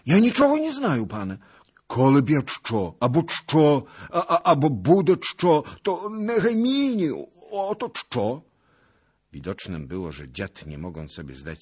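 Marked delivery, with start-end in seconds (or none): 0.1-8.4 very high, loud, stammering babble; panicked old beggar. Acted. Beggar threatened with hanging babbles at the lord, panicked. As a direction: squeal out confused excuses.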